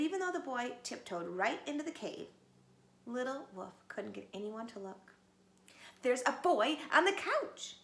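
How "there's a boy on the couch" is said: loud high howl. A narrator's reading. Little Wolf howls at his parents. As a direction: howl it with urgency. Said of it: "he howled".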